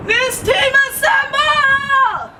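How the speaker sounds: slow, high team rally shout. Acted. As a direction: call the team together like a superhero.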